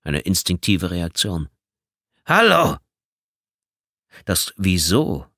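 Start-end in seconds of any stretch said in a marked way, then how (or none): 2.2-2.8 higher, louder call. Acted. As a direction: call into the dead line. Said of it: "rief er".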